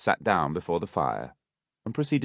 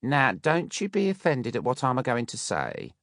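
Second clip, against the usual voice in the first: higher, coaxing sugary matron; sweet Mrs Mann; 6 semitones higher than usual. A narrator's reading. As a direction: wheedle him sweetly. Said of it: "with captivating sweetness".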